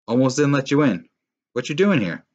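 loud, high, cold dad voice; cold father. Acted. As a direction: bite it out coldly. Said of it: "Miles's father asked, cold".

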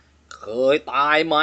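low, slow, quavering ghostly ask; trembling voice. Acted. Driver asks the scared passenger, slow trembling voice. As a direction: let it shake out slowly and low. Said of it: "พูดเสียงสั่นๆว่า".

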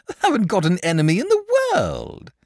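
cheerful dragon. Acted. Dragon cheerfully denies having enemies. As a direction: toss it off happily. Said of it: "said the dragon cheerfully".

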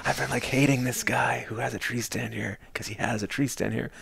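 hushed mock grumble. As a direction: murmur it as a friend's confession. Repeats friend's hushed confession.

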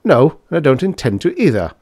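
firm, irritated rebuff; decisive refusal. A narrator's reading. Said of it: "said with decision".

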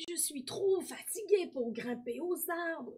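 slow, high, weary voice; tired old man. A narrator's reading. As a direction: complain of tiredness, drawn out and high. Tired old boy declines climbing. (vieil homme fatigué, voix haute et lente)

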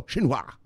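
"Xinhua" said "shin-hwa". Shown as a demonstration. Summing up the lesson for viewers, "Xinhua" as shin-hwa. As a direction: begin "Xinhua" with a sh sound. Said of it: "it's pronounced sh".

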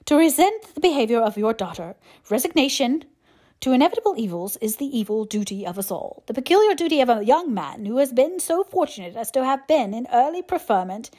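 firm, formal speech with a touch of displeasure; displeased. A narrator's reading. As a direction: lecture stiffly, mildly displeased. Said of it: "in a voice that marked his displeasure".

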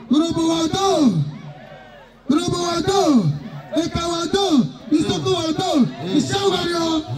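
shouted call-out. Real, not acted. MC calling the names out to the crowd, shouting. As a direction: call every name out to the whole hall.

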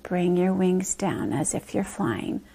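whispered exhale guidance; slow breath out. Shown as a demonstration. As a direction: breathe it out softly. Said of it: "blow a deep breath out".

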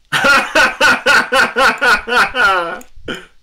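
loud, high burst of laughter. Real, not acted. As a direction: laugh out in disbelief. Laugh at odd move.

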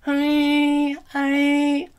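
weird high-pitched noise; high larynx squeak. Shown as a demonstration. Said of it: "I can raise it like this".

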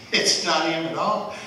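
normal talking voice

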